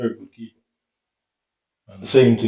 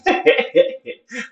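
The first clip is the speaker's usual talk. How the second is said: laugh; 13 semitones higher, 4 dB louder than usual. Real, not acted. Laughs at own story.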